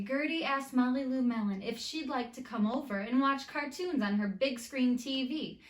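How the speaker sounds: usual speaking voice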